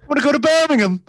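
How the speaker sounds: put-on high, loud British kid; child British accent. Child asking dad to go to Birmingham, high British squeal. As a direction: squeal it like a little Brummie. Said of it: "kid with British accent".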